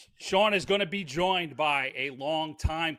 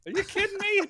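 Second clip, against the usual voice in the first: incredulous; 3 dB louder, faster than usual. Retold or imitated. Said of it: "It was like".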